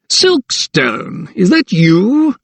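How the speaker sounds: high, pompous magistrate calling down the stairs; calling down from the landing. A narrator's reading. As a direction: call down to him like a self-important magistrate. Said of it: "he called".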